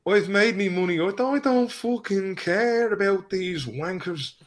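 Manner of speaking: cocky Dublin voice, dragging it out; Dublin swagger. Retold or imitated. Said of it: "and he's like".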